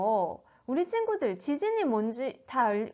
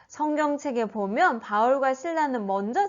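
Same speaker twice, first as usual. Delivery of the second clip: plain reading voice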